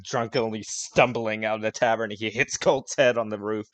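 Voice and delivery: normal talking voice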